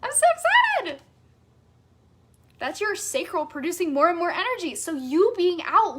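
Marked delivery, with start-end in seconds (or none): 0.0-1.0 much higher and louder, bubbling over; giddy, breathless yes-yes-yes building up; ecstatic yes. Shown as a demonstration. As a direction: squeal each yes with rising joy. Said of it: "have you ever felt that energy of".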